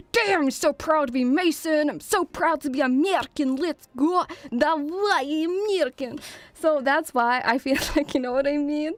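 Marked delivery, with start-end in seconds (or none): 0.1-6.2 hammed-up American gamer accent; proud American. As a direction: play a proud American soldier, higher-pitched.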